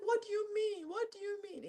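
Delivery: high, soft little voice; small high-pitched mock protest; acted protest. Acts out protest to mother.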